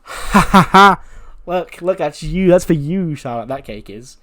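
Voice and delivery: high, loud, taunting cackle then jeer; mocking laugh. Sasha mocking Charlotte over the cake, loud taunting laugh. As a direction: laugh in her face and point. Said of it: "Sasha just points at it and goes".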